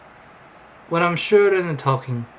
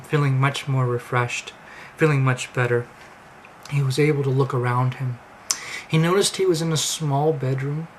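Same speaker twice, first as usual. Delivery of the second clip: usual speaking voice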